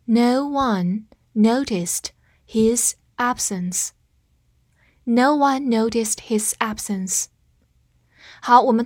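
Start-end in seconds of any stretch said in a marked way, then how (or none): 0.1-3.9 slow, word-by-word model reading; slow read-along. Shown as a demonstration. Teacher reads with the class, slow read-along. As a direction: read slowly, separating the words clearly. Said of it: "我们慢读一遍".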